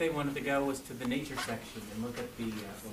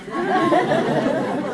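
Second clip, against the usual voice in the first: loud high burst of laughter; big laugh; 14 semitones higher, 12 dB louder than usual. Real, not acted. Laughing at own joke.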